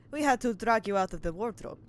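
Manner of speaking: hammy Russian sailor; terrible Russian accent. Acted. Player as Russian sailor to the man found in a wardrobe, bad Russian accent. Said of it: "just a terrible Russian accent".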